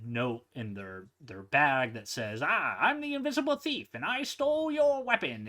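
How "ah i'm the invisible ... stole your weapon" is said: high, fast, mocking thief voice; taunting thief. Thief taunting the three guys, high and fast. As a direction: gloat like a sneaky thief.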